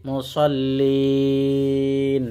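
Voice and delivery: slow, drawn-out chanted recitation; Quran recitation. Shown as a demonstration. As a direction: recite with long melodic stretches. Recites the verse. (ধীর সুরেলা কুরআন তিলাওয়াত)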